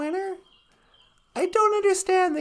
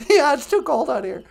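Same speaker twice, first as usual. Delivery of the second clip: normal talking voice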